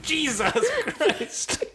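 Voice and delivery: very high, very slow laughing groan; laughing exclamation. Retold or imitated. Struggling with the mic screw, high slow laughing groan. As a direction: stretch it out laughing in disbelief. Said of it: "I was like".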